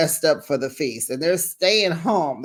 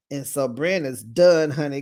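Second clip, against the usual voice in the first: ordinary talk, nothing marked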